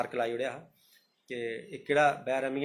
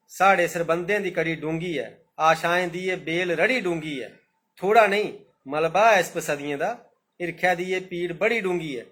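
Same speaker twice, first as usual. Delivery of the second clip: slow, measured verse reading; poem recitation; 2 semitones higher, 5 dB louder than usual. Acted. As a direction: let each line of the verse land. (कविता पाठ, होलें होलें)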